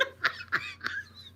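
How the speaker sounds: long, squealing high laugh; helpless laughter. Real, not acted. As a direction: crack up completely. Real laughter at the punchline.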